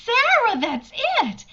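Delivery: bursts out high and loud with relief; excited realization. Acted. Teacher realizing a way out, excited cry. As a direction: seize on the idea with a rising thrill. Sudden idea of escape.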